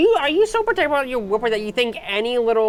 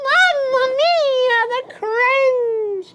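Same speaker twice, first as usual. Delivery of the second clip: high, slow hammed-up Italian cry; mock Italian wail; 11 semitones higher, 3 dB louder, much slower than usual. Streamer hamming up an Italian cringe wail, high and slow. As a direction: wail Mamma mia like a stage Italian. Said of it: "The cringe made me Italian".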